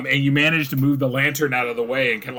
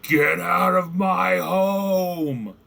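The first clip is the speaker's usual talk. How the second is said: long, drawn-out roaring creature voice; monstrous roar; 5 semitones higher, much slower than usual. Acted. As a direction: roar it slowly at an intruder, higher pitched. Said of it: "you hear the roar behind you again".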